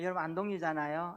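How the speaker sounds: ordinary talk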